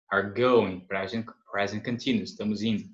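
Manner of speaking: ordinary talk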